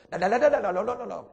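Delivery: loud rapid la-la babble; tongue trill babble. Shown as a demonstration. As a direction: roll the tongue in fast syllables. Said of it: "이렇게 나오나".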